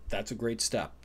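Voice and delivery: normal talking voice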